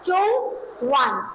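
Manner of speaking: slow, careful चुंग वन with a clear u in चुंग; चुंग वन drawn out for students to repeat; model pronunciation. Shown as a demonstration. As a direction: say चुंग वन slowly, syllable by syllable, like a teacher. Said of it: "क्या पढ़ेंगे?".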